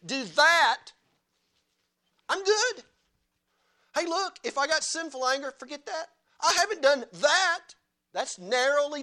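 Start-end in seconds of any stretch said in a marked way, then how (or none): 0.1-7.6 higher, louder self-satisfied brag; smug Pharisee. Retold or imitated. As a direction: brush off sin like a proud Pharisee. Preacher voices a smug Pharisee.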